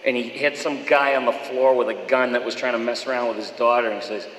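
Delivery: normal talking voice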